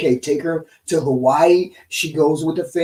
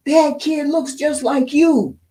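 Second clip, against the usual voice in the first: much higher, slow, marvelling; amazed remark; 11 semitones higher, 4 dB louder, slower than usual. Retold or imitated. Brother on Facebook tells his brother the kid is his, amazed. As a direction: linger on the likeness in wonder. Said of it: "posting pictures saying".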